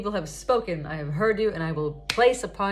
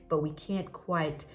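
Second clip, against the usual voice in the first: usual speaking voice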